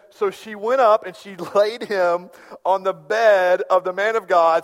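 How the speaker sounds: slow, declaimed reading of the verse; slow pulpit reading; scripture reading. Acted. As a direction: read it out to the congregation. Reads scripture verse aloud.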